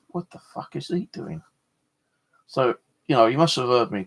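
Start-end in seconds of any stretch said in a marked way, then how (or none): 0.1-1.4 much softer, wary under-the-breath question; hushed puzzled thought. Retold or imitated. Creeped out by a crouching stranger, a hushed wary question. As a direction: keep it down, wondering what he's up to.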